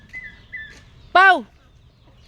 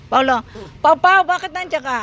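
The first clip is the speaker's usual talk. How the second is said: loud call, rising in pitch; 3 semitones higher, 7 dB louder than usual. Real, not acted. Vlogger asking Paulo why he is there, calling out. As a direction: call out to a wayward pet.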